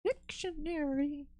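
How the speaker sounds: "Dictionary" high, near silent and slow; high breathy whisper. Shown as a demonstration. Alone drawing, a high near-silent whispered dictionary. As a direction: breathe the word high and soft. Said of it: "that specific cadence".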